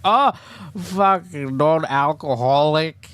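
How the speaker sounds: hammed-up scornful boozer; scornful drinker. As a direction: jeer at a non-drinker.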